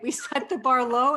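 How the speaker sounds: ordinary delivery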